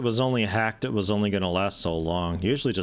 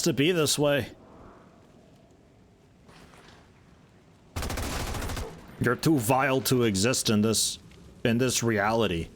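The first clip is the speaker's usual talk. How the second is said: slightly raised, theatrical regret; mock-solemn apology; 4 semitones higher than usual. Player to the doomed Andromedon before killing it, mock-solemn. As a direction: play at a solemn execution speech.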